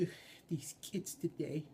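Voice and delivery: mildly exasperated puppet grumble; very annoyed. Acted. Puppeteer voices his favorite puppet expression, mock annoyed. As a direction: grumble with an opening "ugh". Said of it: "where he gets very annoyed".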